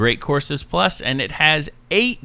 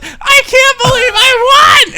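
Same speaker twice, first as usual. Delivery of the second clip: put-on very high, slow, loud kid wailing with joy; sob-shout like an overjoyed child; high, loud mock kid crying with joy; crying-happy kid; 8 dB louder, slower than usual. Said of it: "And he goes".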